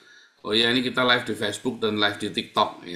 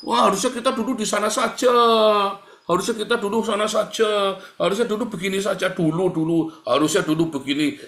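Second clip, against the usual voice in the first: higher whining regret; complaining grumbler; 8 semitones higher, 3 dB louder than usual. Retold or imitated. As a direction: moan over and over about what should have been. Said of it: "Ini toksik biasa". (orang toksik mengeluh menyesali masa lalu, merengek tinggi)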